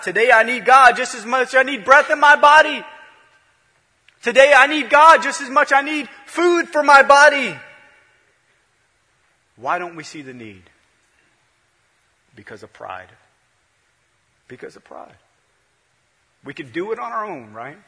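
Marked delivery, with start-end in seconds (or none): 0.0-7.6 loud, high, driving sermon cadence; full-force preaching. Acted. 14.5-15.2 the repeat breathed out as a whisper, no voice. Shown as a demonstration. Repeats answer, then whispers it.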